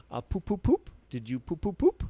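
put-on high, playful sing-song to a toddler; baby talk. Mother teasing the toddler with silly baby talk. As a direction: coax a giggle out of a baby. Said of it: "all Christy's saying to her is".

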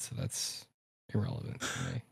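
unvoiced whispered aside; whispering. Real, not acted. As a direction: drop to a breathy whisper.

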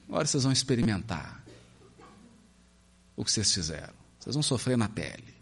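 ominous threat. Acted. Jonas threatens Nineveh.

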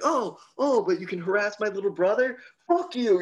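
retold protective brother yelling; angry retort. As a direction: tell the bully off, fired up. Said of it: "he was like".

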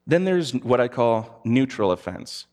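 ordinary talk

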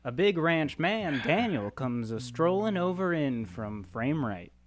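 measured script reading of the scene; reading stage directions to the cast, measured. Acted. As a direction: set the scene like a screenplay narrator. Reads sketch stage directions.

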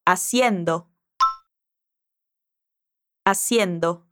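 Haciendo said a-SYEN-do with no h; Spanish haciendo. Shown as a demonstration. Teacher models the gerund for the student, clearly.

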